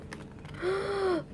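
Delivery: high gasp of excitement; breathless intake of surprise; amazed gasp. Real, not acted. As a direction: gasp in disbelief at the luck. Sees another card not owned.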